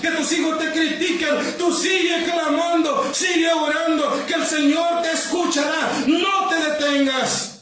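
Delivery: high, relentless shouted exhortation; fervent pulpit shouting; full-force preaching. Real, not acted. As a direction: drive the congregation on at the top of your voice.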